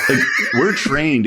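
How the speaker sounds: laughing. Real, not acted. Host amused at the chatbot's answer, laughing through it. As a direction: chuckle the words out slowly.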